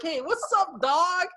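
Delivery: high, hammed-up wannabe cool greeting; cool slang lingo. Mocking the actor every time he shows up, high. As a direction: play the too-cool guy, high. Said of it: "he's like".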